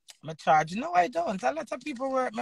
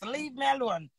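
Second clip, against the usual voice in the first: usual speaking voice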